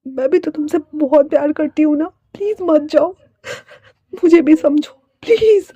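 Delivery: high, beseeching acted voice; tearful pleading. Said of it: "Fei वापस से कहती है".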